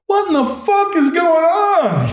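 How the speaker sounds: very high, drawn-out bewildered cry; incredulous outcry. Retold or imitated. Crew demanding answers from the cattle boat's captain, incredulous. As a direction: act out calling up in utter disbelief.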